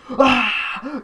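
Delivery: high, loud scream of shock; startled scream. Retold or imitated. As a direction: jolt awake screaming. Waking to old lady.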